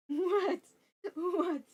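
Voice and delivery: ordinary talk, nothing marked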